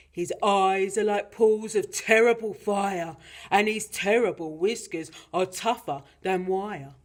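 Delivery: loud, dramatic monster dad; Gruffalo father, ominous. Acted. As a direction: build up the terrible beast in a big voice. Gruffalo describes the mouse to child.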